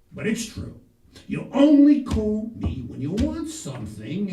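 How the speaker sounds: scolding dad. Retold or imitated. The dad scolds his son.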